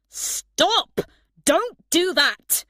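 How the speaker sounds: high, loud shout at a thief. A narrator's reading. Dexter shouting at a boy stealing his clothes. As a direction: yell it after the boy down the beach. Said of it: "Dexter shouted".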